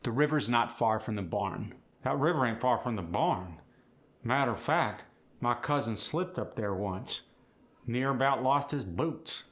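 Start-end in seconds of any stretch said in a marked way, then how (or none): 2.0-9.4 Appalachian storyteller with full R's; Appalachian accent. Acted. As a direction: spin it as an Appalachian yarn. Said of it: "There's the storytelling again".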